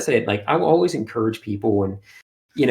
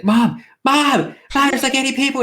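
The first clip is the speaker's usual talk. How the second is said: put-on high, loud excited kid calling his mom; 5 dB louder than usual. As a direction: squeal it like a thrilled little boy. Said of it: "he's like".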